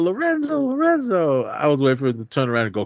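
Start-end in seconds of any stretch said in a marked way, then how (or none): 0.0-1.4 very high-pitched call after husband; wife calling out. Retold or imitated. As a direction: imitate a wife calling from the window.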